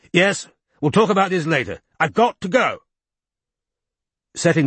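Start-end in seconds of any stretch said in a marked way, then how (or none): 0.1-2.8 higher, impatient bark at the phone. A narrator's reading. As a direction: cut the caller off curtly. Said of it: "barked Chisel into the mouthpiece".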